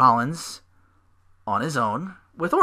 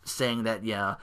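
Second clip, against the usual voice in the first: usual speaking voice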